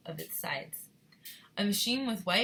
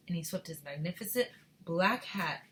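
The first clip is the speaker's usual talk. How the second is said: plain reading voice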